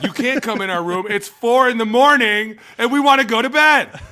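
loud, high, rising protest at the police; retold yell. Sleepy players yelling at police at the door, loud.

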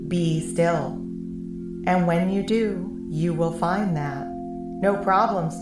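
ordinary delivery, nothing marked

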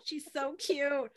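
high, soft, drawn out slowly; adoring coo. Real, not acted. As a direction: coo over an adorable puppy. Gushing over puppy, half laughing.

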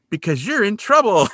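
high, mock-gleeful taunt; teasing. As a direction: deliver bad news as a playful tease. Colleague teasing Kolchak with bad news.

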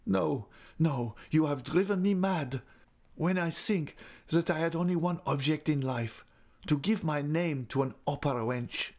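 put-on high, agitated young man; anguished suitor. A narrator's reading. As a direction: reject her in bitter distress. Tearful man rejects her forgiveness.

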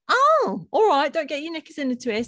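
high, loud huffy brush-off; dismissive mock voice. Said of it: "they'll be like".

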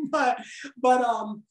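usual speaking voice

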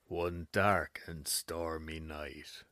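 slow, low spooky narrator; Ghost Adventures voice. Acted. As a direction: drag it out like a ghost-hunting host. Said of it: "I'm the gonna do Ghost Adventures voice for this".

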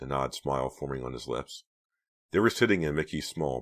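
plain reading voice

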